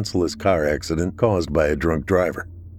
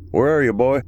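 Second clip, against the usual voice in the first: calling out higher and louder into the fog; yelled call; 6 semitones higher, 4 dB louder than usual. A narrator's reading. Ex-soldier calls to the whimpering dog, loud call. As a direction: shout it into the mist for the dog. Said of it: "he yelled".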